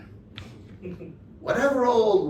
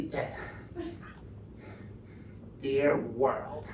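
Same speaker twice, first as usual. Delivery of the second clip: much lower, softer prim Starr voice; mock Kenneth Starr; 8 semitones lower, 8 dB quieter than usual. Mocking Starr drafting his report, low and soft. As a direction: drop low and quiet as a sanctimonious prosecutor.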